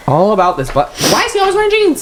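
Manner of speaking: rising into a high squeal; exasperated squeal. Real, not acted. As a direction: squeal in disbelief. Exasperated squeal about jeans.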